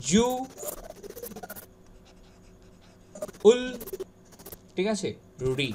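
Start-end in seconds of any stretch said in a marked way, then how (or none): 0.1-3.6 "জু", long pause, "উল"; syllable-by-syllable demo of জু and উল; correct pronunciation. Shown as a demonstration. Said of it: "এর উচ্চারণ হবে হচ্ছে". 5.6-5.7 "রি" as a separate final syllable; isolated রি; a lone, clear "ree" for রি; correct pronunciation. Shown as a demonstration. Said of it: "এর উচ্চারণ হবে হচ্ছে".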